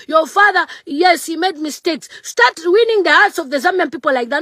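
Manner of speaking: ordinary talk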